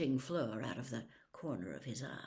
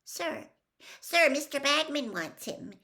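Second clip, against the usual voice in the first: put-on higher, louder nervous boy; 10 semitones higher, 6 dB louder than usual. Schoolboy pleading with the stern teacher, nervous and high. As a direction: blurt it out, anxious to be heard. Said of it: "he said nervously".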